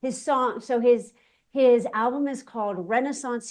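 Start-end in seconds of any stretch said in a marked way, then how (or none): none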